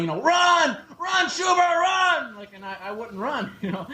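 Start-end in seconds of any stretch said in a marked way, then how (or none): 0.2-2.3 high, bellowing coach shout; coach yelling. Retold or imitated. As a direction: bark it across the field like a gym coach. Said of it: "always be yelling".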